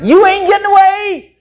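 high, loud playful grab; gleeful, won't let go; excited. Acted. Mary grabbing his feet, loud high playful claim.

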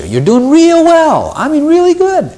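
very high, drawn-out compliment; gushing praise. Acted. God praising the church before pointing out its faults, gushing praise. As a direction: lay the praise on high and slow.

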